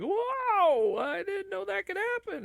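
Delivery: very high, hammed-up astonishment; fake surprise. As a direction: pretend you never saw it coming. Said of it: "then you're like".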